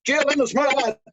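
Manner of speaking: high, loud shout of blessing. Acted. Bachelor in the rafters shouts the blessing at the bride, loud and high. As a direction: shout it out to break the spell. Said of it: "he shouted out".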